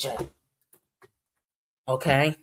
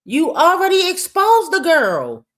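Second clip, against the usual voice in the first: much higher and louder, scoffing retort; incredulous snap; 11 semitones higher, 8 dB louder than usual. Real, not acted. Host retorting at the clip, loud and scoffing. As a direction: fire it back at her, disbelieving.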